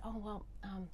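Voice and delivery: soft, hushed and hesitant; a hushed, bashful confession; shy. Retold or imitated. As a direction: tell it shyly, half whispering. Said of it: "kind of shyly".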